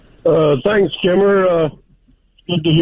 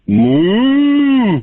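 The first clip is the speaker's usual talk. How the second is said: cow moo; 5 semitones higher, 4 dB louder than usual. Retold or imitated. Caller voices girlfriend's mom.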